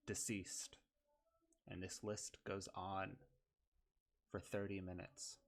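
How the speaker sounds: normal talking voice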